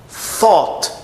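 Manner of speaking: "Thought" with unvoiced TH, high falling tune; TH sound. Shown as a demonstration. Teacher closes the recap, "Thought" with TH, falling. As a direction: start "Thought" with tongue between teeth, drop the end. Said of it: "com som de T H".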